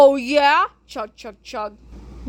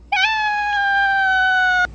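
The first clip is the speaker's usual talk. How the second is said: cheering shout. Acted. Heroes cheer.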